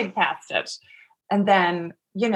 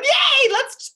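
much higher, forced bright mock cheer; fake cheer; 4 dB louder than usual. Back at the baby shower after crying, fake cheer. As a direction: paste on a fake happy party voice.

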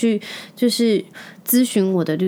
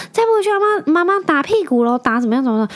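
put-on higher, sing-song threat to a child; mock nagging neighbor; 8 semitones higher than usual. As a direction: ham up the busybody's warning. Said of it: "他说".

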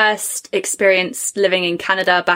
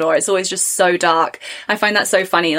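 ordinary talk, nothing marked

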